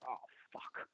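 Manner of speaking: breathy whispered "oh, fuck"; whispered dismay. Retold or imitated. Reacting under his breath to becoming a meme, whispered dismay. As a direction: mutter it under the breath in dread.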